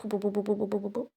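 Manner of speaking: rapid "boo-boo-boo" shooting noise; mouthed gunfire. Retold or imitated. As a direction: imitate a burst of shots. Imitates shooting his friend.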